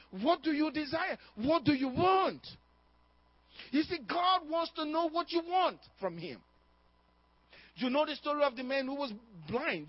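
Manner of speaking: ordinary talk, nothing marked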